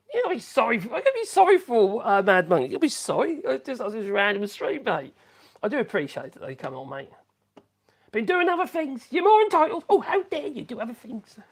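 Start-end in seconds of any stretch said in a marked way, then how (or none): 0.1-3.5 high, teasing singsong warning; jokey higher "you'll be sorry"; mock threat. Mock threat reading chat. 8.1-11.4 much higher, hammed-up huffy voice; playful fake offence; mock indignation. Mock offended at viewer's absence.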